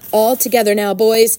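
loud, hearty work call; rally the team for a push. A narrator's reading. Farmer rallies men pushing the pig, calling out.

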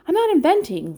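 put-on high, loud indignant child; girl protests her mother's accusation, indignant and high. A narrator's reading. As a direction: protest she's telling the truth. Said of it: "said Fern".

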